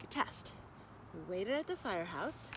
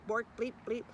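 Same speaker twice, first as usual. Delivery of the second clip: ordinary delivery, nothing marked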